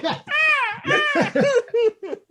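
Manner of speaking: very high, loud mock seagull cries; shrieking bird calls breaking into laughter; seagull squawk imitation. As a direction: screech like a gull, then laugh. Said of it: "What does the seagull say?".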